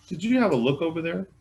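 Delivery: high, fast question; sheriff asking. Acted. Sheriff asks.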